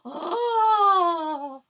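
sharp high gasp then a loud Oh!; loud excited gasp. Real, not acted. Hearing about glitter palettes, a loud excited gasp. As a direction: gasp in thrilled shock.

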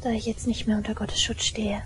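soft whispered regret; sad whisper. Acted. Girl whispers to listener she can no longer protect him. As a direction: let the words fade into a whisper.

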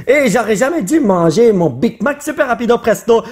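ordinary talk

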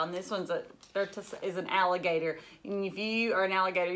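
usual speaking voice

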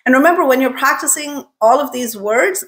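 ordinary talk, nothing marked

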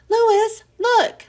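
high, loud eager call for attention; a child bursting to show her find. Acted. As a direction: cut in and call him over. Girl interrupts to call Louis.

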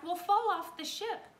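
high, loud alarm; warning shout. A narrator's reading. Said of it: "shouted Jack".

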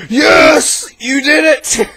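high, loud cheer with a gasp; gasp, then cheer her on high and loud. Acted. Excited friend cheers high and loud over the breakup.

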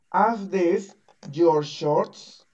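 very slow Are these your shorts; teacher models the question slowly. Shown as a demonstration. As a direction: spell out each word slowly. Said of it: "yo te pregunto a ti".

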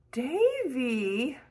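a hint of a shocked guardian; reproachful exclaim. A narrator's reading. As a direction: call his name to scold him. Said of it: "she exclaimed reproachfully".

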